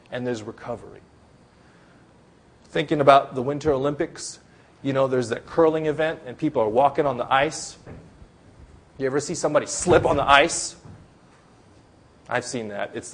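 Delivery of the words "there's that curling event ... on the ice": usual speaking voice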